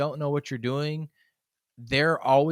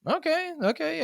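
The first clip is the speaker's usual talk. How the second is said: mock placating okay.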